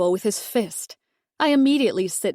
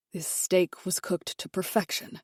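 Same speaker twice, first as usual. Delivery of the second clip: tight, much softer, tense; husband forcing calm to the maid, tense and softer; 8 dB quieter than usual. Acted. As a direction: hold the anger down tightly. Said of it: "he says in a tense voice".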